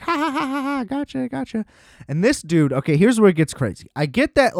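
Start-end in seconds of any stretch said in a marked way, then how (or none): 0.0-1.6 much higher, giggling gotcha that fades softer; taunting laugh. Retold or imitated. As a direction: laugh at him like a kid whose prank worked. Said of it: "the kid's like".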